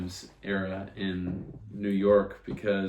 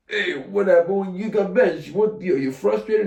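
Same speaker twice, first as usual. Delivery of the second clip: high, louder, slow mock reporter voice; 11 semitones higher, 8 dB louder than usual. Podcaster voices reporter asking benched quarterback, high mock voice. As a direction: ham up a nosy reporter.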